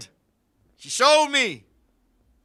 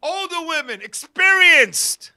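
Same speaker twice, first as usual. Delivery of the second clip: excited shout; 5 semitones higher, 7 dB louder, slower than usual. Real, not acted. Shouted punchline.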